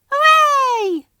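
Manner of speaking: very high little cheer; peeped cheer. A narrator's reading. Flora cheering for meeting Toby, high peep. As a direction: squeak with delight. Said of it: "she peeped".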